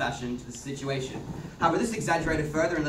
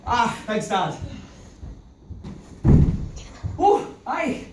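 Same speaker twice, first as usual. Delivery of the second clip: a lively, louder Irish brogue; Irish accent; 9 semitones higher, 4 dB louder, slower than usual. Acted. Man in Irish accent fussing over a bloodied girl. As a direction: play it broad and rural Irish.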